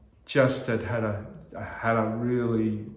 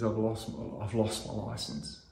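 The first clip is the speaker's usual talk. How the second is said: son in tears; 3 semitones lower, 11 dB quieter than usual. Retold or imitated. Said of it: "and he's in tears".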